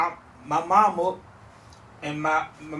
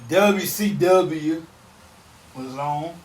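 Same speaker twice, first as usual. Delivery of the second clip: drawn-out, exaggerated country twang; very slow hammed-up country accent; mocking country accent; much slower than usual. Shown as a demonstration. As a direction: stretch it out in a mock country accent. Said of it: "say it in a, in a mocking country accent".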